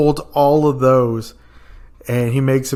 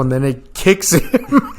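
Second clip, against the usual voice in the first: ordinary talk, nothing marked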